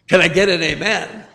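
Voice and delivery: normal talking voice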